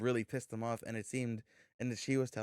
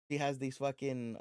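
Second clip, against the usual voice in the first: ordinary talk, nothing marked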